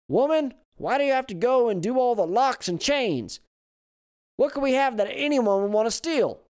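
put-on high, loud complaining old man; grumpy old man. A narrator's reading. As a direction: gripe at the wife.

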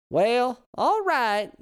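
put-on high, loud old lady; old woman voice. A narrator's reading. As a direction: concede like a granny.

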